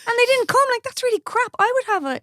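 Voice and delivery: high, worked-up protest for someone else; indignant outrage. Retold or imitated. Stylist defending a let-down bride, high indignant outrage.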